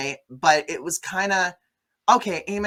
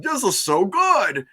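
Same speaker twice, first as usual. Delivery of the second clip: slow, higher, over-the-top delight; mock gushing actress; 7 semitones higher, 4 dB louder, slower than usual. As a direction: ham up her rapture over the food.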